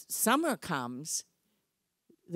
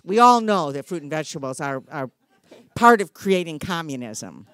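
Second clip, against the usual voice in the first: dry sarcasm, louder, laughing; mock the claim with a laugh; sarcastic; 8 dB louder than usual.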